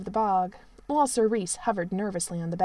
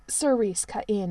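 plain reading voice

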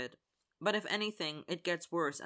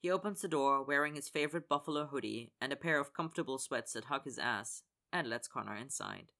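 usual speaking voice

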